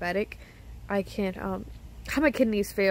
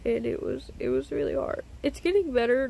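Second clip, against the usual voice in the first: usual speaking voice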